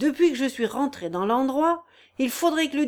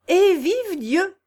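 higher, louder and slow, a hearty cry; joyful exclamation; 7 semitones higher, 5 dB louder, much slower than usual. A narrator's reading. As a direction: burst out gladly, drawing it out. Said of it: "s'écria Antoine".

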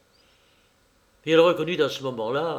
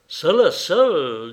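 slow, louder, savoring each word; delighted surprise; 3 semitones higher, 6 dB louder, much slower than usual. Acted. Old suitor echoing her words, slow delighted surprise. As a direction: stretch it out, hardly believing it.

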